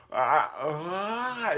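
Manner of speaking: gaping breathy fish noises; a half-hearted fish impression. Shown as a demonstration. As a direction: gulp air like a fish out of water. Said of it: "this is my fish impression".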